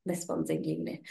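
low, soft whispered aside; deadpan whisper. Retold or imitated. Redirecting Chatterbox to Melissa, low whispered aside. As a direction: murmur it while pointing at your sister. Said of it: "I went".

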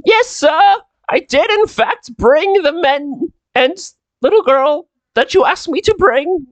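very high, deferential servant; Micah. Acted. Servant reports to old master on the guests, high obliging voice. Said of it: "Micah knocks on the".

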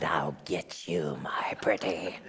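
low, slow menacing witch whisper; whispered witch's threat; Wicked Witch of the West. Acted. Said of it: "Wicked Witch of the West".

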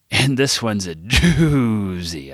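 slow, drawn-out DOOZY; stretched out. A narrator's reading. Narrator copying Harper's way of saying it, stretched out. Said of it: "I stretched it out like Harper did".

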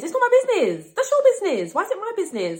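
very high, loud comic protest; mock outraged squeal. Protesting that others' demands are not hers, squealed mock outrage. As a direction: shoot way up into an exasperated squeal. Mock outburst escalating from the refusal.

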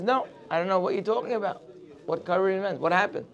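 soft, fast and puzzled; quick quiet reply. Retold or imitated. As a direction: rush it out under your breath. Sarah answers the Satan, puzzled.

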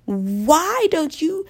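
exaggerated mock comeback; mock snap. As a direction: bite back at the critic, hammed up. Snapping back at imagined critic.

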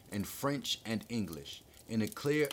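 ordinary delivery, nothing marked

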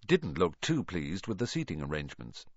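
ordinary delivery

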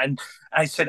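usual speaking voice

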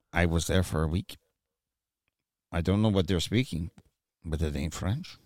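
low, softly put-on French accent; lightly mocked French accent, voice dropped. Friend back from Quebec telling his pal, in a French accent. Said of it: "I love [name]'s French accent".

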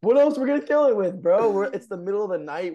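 high, loud mock protest; worked-up, incredulous outburst; retold exasperation. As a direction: imitate a friend pleading in exasperation. Retold panicked argument about killing it.

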